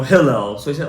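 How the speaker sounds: normal talking voice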